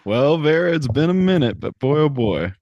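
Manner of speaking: easy cowpoke bravado; a hint of a Western gunslinger; swaggering cowboy.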